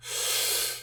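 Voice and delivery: long, slow audible breath; deep calming breath. Shown as a demonstration. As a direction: demonstrate a deep breath to calm down. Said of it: "breathe deeply and close your mouth".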